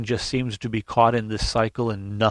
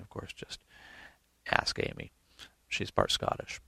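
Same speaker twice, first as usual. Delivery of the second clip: teasing whisper aside to the congregation; playful whispered aside; 7 semitones lower, 12 dB quieter than usual. Real, not acted. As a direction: drop to a whisper for the joke. Joking aside about Amy.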